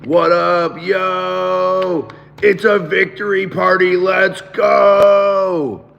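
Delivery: loud, high, hoarse celebration cheer; hoarse victory shout. Real, not acted. Streamer greets his chat with a hoarse victory shout. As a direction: belt it out with a wrecked voice. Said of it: "As you guys can hear, my voice is just absolutely butchered".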